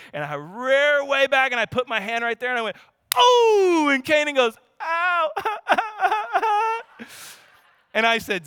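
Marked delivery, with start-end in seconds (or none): 3.1-3.9 high loud fake "oh" as hand lands; mock spanking yelp. Dad faking a spank to fool his son, hammed-up yelp. 4.8-7.0 high, hammed-up fake "ow" into a giggle; fake cry. Son pretending to be spanked for his dad, mock cry. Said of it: "Just fake cry for me".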